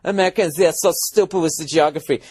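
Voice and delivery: high, mincing put-on Danish accent; sneering imitation, Danish accent; mocked Danish cabbie.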